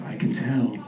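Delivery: low, hushed mystic delivery; low-pitched, measured clairvoyant pronouncement; psychic medium voice. Retold or imitated. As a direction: intone it like a spirit medium. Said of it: "She has this very medium voice".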